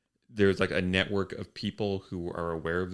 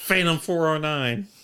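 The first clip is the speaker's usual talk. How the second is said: higher, louder CB radio call; CB trucker voice; 7 semitones higher, 6 dB louder than usual. Pretend. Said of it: "they're all on the C B".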